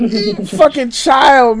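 slow, high, amused put-down; laughing mock scorn. Real, not acted. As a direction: tease through laughter. Mocking the younger coworker.

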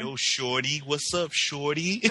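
a slow, lightly put-on Southern drawl from a New Yorker; lightly mock a New Yorker faking a Southern accent. New York rapper faking a Southern greeting, mild mock Southern accent. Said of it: "people from New York who try to have Southern accents".

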